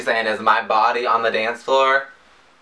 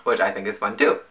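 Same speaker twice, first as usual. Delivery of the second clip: ordinary delivery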